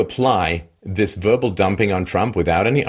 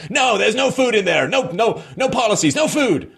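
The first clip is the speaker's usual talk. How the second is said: high hammed-up rant; mock ranting owner; 12 semitones higher than usual. Bitter restaurant owner ranting at rival's customers, high mock rant. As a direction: ham up a bitter shopkeeper yelling at passers-by. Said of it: "he's just screaming".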